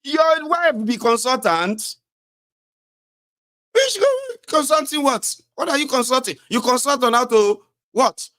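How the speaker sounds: high, loud incredulous questioning; mock disbelief. Real, not acted. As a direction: fire squealing questions at an absurd excuse. Mocking the wife's consultant job.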